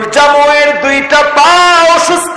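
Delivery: high, ringing sermon climax; full-force preaching. Acted. Preacher praising a martyr to the crowd, full-force chanted preaching. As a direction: push each chanted phrase up to rouse the crowd.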